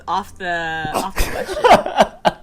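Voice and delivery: laughing. Real, not acted. Laughing at off-topic answer.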